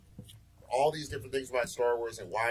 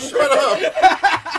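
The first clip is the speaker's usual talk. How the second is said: high loud laughing shout; 16 dB louder than usual. Real, not acted. Host yelling at teasing co-hosts, laughing shout. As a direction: yell it through a laugh.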